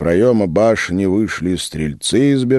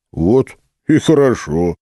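glad; 4 semitones higher, 4 dB louder than usual. Acted. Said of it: "обрадовался Филипп".

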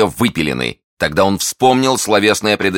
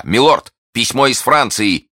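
high, raised call with news; shouting; 7 semitones higher, 3 dB louder than usual. A narrator's reading. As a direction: servant bursting in with an announcement. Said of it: "крича".

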